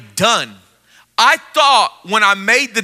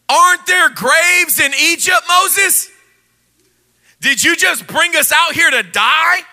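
angry complaining crowd; 5 semitones higher than usual. Acted. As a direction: yell at Moses like a fed-up crowd. Angry Israelites complain to Moses.

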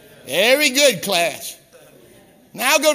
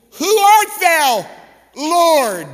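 high, loud, slow cry of a man thrown to the ground; stunned plea to an unseen voice; awestruck cry; 8 semitones higher, 7 dB louder, much slower than usual. Retold or imitated. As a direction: gasp it out in awe, drawing each word. Saul struck down on road.

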